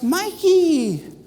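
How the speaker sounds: high, loud, joyful shout of his name; delighted call. Retold or imitated. Mentor spots her student outside, delighted shout.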